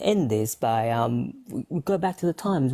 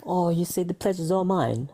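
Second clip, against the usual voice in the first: put-on higher-pitched English accent; reply in a mock English accent; 5 semitones higher than usual. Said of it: "with an English accent".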